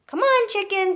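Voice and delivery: high coaxing call; coaxing the hen. Acted. George coaxes the greedy hen, high and bright. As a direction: lure the chicken closer. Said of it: "George said".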